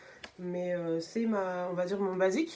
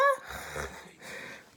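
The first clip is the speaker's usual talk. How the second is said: short high airy laugh. Real, not acted. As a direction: laugh it off, amused. Reacting to the makeup artist.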